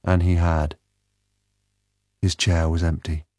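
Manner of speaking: usual speaking voice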